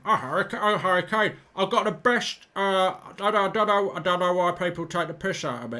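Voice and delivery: mock-grumble as the striker; Harry Kane impression. Fumbling the Harry Kane voice for the viewers. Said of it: "I used to be able to do Harry Kane".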